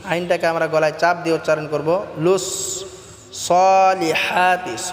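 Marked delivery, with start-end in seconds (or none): 3.5-4.7 "সলিহাতি" with the "হা" drawn out one alif, pressed in the throat; long vowel. Shown as a demonstration. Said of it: "গলায় চাপ দিয়ে এক আলিফ টেনে পড়ব". ("সলিহাতি"-র হা গলায় চাপ দিয়ে টেনে)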